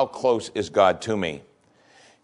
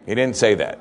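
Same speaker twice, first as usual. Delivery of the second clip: normal talking voice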